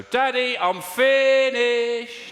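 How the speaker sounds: put-on high, loud, long-drawn singsong kid; stretched high-pitched child's holler; little child calling. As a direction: call out from another room like a small child. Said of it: "I heard a little voice go".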